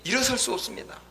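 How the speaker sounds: high, impassioned prayer plea; wholehearted crying out. Acted. Preacher acting out a prayer to God, wholehearted crying out. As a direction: call up to God with all your heart. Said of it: "전심을 다해".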